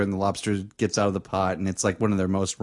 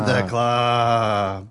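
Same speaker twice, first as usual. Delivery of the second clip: Toy Story. Acted. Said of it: "Toy Story".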